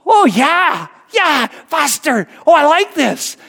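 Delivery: high, drawn-out mock whoops of delight; thrilled kid. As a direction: imitate a kid suddenly loving it. Retold boy starts enjoying the speed.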